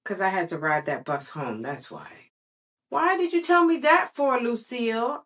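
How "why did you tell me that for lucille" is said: high, loud whiny complaint; kind of grouchy. Acted. Junie B snaps grouchily at her classmate Lucille. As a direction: grumble it like an upset kid. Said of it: "I said, kind of grouchy".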